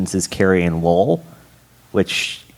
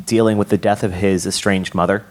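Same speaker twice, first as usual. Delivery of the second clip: normal talking voice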